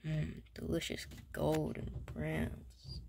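soft, slow savouring murmur; hushed craving. Acted. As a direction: dream of the turkey aloud. Savouring the food again.

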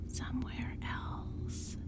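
very slow whispered echo; dry whisper, like dead leaves crinkling; hoarse crackle. A narrator's reading. Second pumpkinhead echoing her partner, in a hoarse crackling whisper. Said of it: "Her voice also came out in a hoarse crackle".

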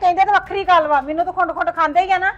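angry retort. Acted. Mother retorts.

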